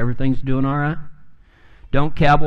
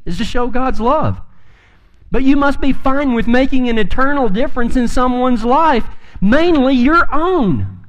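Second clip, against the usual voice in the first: full-force preaching; 8 semitones higher than usual. Acted. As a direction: preach it out to the whole room. Pastor preaching to congregation.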